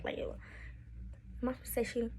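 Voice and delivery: hushed, near-voiceless question; whispered aside. Real, not acted. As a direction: whisper it as if censored. Hushed aside about forbidden word.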